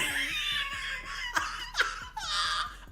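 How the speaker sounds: very high squealing laughter; long helpless shrieking laugh; hysterical laugh. Real, not acted. Cracking up at the clip.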